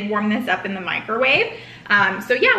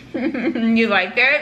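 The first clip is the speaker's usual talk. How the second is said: chewing while talking; laughing through food; mouth full; much slower than usual. Real, not acted. Seen 1: Speaker likely woman; bit sandwich at -2.0, still chewing, lips closed while smiling.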